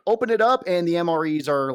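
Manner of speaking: ordinary talk, nothing marked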